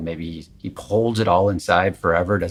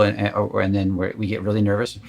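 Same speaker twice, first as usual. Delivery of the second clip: normal talking voice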